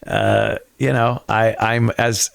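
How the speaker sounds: normal talking voice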